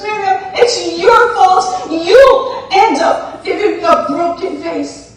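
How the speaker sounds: accusing. Acted. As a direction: blame her loudly, half laughing. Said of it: "she comes running back to Miss Miriam and she goes".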